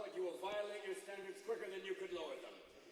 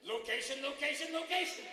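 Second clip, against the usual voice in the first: loud, high, climbing chant; gleeful slogan; 7 semitones higher, 9 dB louder than usual. Acted. Devil flattering Osama on the phone, gleeful chant. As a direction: ring out each repeat.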